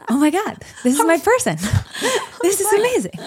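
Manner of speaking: high and loud, laughing through it; giddy delight. Retold or imitated. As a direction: gush with delight. Thrilled by his accent play.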